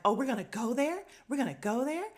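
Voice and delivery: high, loud indignant quote; mock indignant Rena. Host imitating Rena daring Kathy to go there. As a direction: throw the challenge back, offended.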